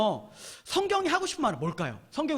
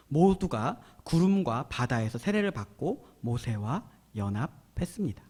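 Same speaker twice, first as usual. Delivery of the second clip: slow, low, measured reading; scripture reading; 8 semitones lower, slower than usual. Real, not acted. Preacher reading Corinthians to the congregation, solemn scripture reading. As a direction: read solemnly with long phrases.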